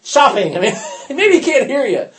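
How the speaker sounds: high, loud mock-innocent teasing; playful jeering, breaking into a chuckle; [name]. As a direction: taunt them with amused suggestions. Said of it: "it was such a riot for [name]".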